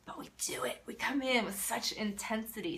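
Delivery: usual speaking voice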